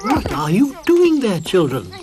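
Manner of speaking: loud, alarmed call; cry out. A narrator's reading. Moomin Mama calls out to the fighting children, loud. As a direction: stop the fight. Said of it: "cried Moomin Mama".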